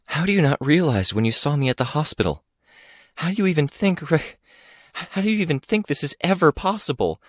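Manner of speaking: high, breaking, out of breath; breathless pleading. A narrator's reading. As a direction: gasp out each broken question. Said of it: "My voice was a whisper again".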